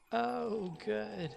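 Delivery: high sarcastic oh good; mock sarcasm. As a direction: sneer it back sarcastically. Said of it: "quoting the Bale rant".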